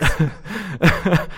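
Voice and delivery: put-on a playful stab at a Scottish accent on McDocs; mock Scottish accent. Cohost repeating McDocs in a mock Scottish accent. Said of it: "with a Scottish accent".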